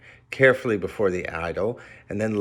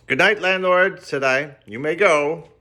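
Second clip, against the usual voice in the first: lofty dismissal; 7 semitones higher, 5 dB louder than usual. A narrator's reading. Dismissing the landlord.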